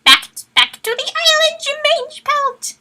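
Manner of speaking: high loud sneering command; villain order. Acted. Asher ordering Bramblestar back to the island, high sneering command. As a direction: bark the order down at him. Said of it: "Asher ordered".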